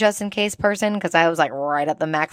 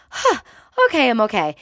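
startled gasp; 5 semitones higher, 3 dB louder, faster than usual. Retold or imitated. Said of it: "you're like".

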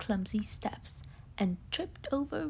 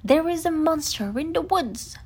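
louder, higher breathless urgency; urgent call; 6 semitones higher, 8 dB louder than usual. Acted. Tigger urges Rabbit to stop and help. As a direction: burst in demanding help. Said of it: "explained Tigger".

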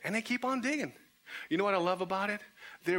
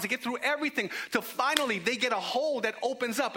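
higher, driving, rhythmic build; impassioned preaching; 6 semitones higher than usual. Real, not acted. Preacher exalts the friends' persistence, impassioned and driving. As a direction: pile up each phrase with mounting fervour.

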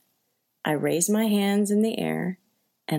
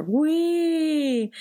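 higher but lazy, unenthused whee; bored teenager wee; 10 semitones higher than usual. Retold or imitated. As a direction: whee like a bored teen on a ride. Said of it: "like a bored teenager riding a roller coaster".

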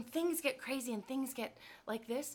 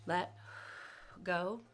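let-go breath; 4 semitones lower than usual. Shown as a demonstration. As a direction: breathe out between the two words. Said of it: "I talked about the let go breath".

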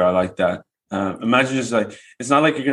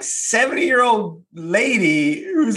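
ordinary talk

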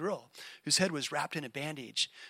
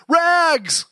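high ringing street cry, much louder; calling out; 12 semitones higher, 14 dB louder than usual. Acted. Ragman calling his wares through the city, ringing cry. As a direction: call it across the alleys. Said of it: "calling in a clear tenor voice".

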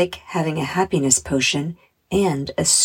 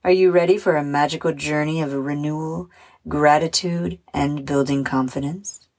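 ordinary delivery

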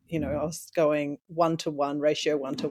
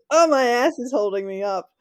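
higher, louder comic exclamation; mock amazement; 6 semitones higher, 7 dB louder than usual. Co-host joking about her grown glutes, mock amazement. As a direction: play up the absurd surprise.

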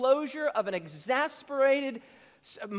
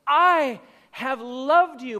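louder, slow and weighty; emphatic love; 2 semitones higher, 8 dB louder, much slower than usual. Retold or imitated. Preacher voicing God's opening line, loud and slow. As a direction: declare it with force. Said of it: "He says".

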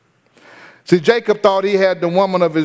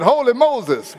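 mock surprise; 8 semitones higher, slower than usual. Alternative exclamation, laughing.

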